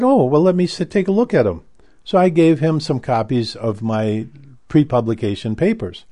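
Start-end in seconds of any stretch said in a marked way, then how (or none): 0.0-1.6 high, keen mock professor voice; eager professor. Imitating the professor grabbing the papers, high and keen. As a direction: pitch it up like an eager colleague.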